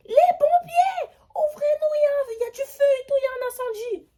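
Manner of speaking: high, loud crying shouts; imitated frantic banging yells; frantic shouting. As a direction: yell through the door in a panic.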